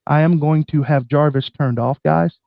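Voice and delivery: usual speaking voice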